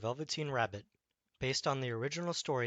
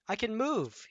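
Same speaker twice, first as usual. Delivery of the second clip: higher, louder burst of delight; joyful cry; 9 semitones higher, 5 dB louder than usual. Acted. Rabbit finds he can move, crying out with joy. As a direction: shout it with sudden joy. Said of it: "he cried".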